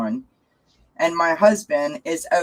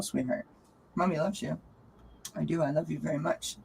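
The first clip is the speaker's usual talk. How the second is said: tender to cat; 9 dB quieter than usual. Real, not acted. Tender words to her cat.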